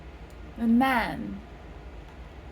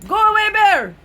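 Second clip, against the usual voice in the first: loud, high shout to scare it off; yelling at bear; 12 semitones higher, 13 dB louder than usual. Pretend. Shouting at a bear to go away, loud and high. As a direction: throw your arms up and yell. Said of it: "put your arms up and say".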